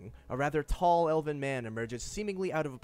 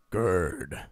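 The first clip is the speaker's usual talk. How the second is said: low armor voice; 8 semitones lower, 6 dB louder than usual. Acted. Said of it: "he says".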